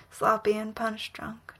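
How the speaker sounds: ordinary delivery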